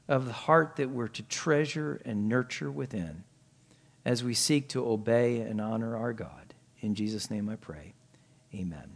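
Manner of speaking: soft, hushed prayer voice; soft earnest prayer, fading to a hush. Real, not acted. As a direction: pray it quietly, reverently. Closing prayer before the sermon.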